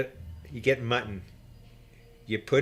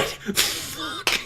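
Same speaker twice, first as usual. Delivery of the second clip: breathless whispered swear; whispered curse. Retold or imitated. Scared deckhand hearing the escape plan, whispered curse. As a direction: let it out as a dismayed whisper. Reacting to hearing they'll flee.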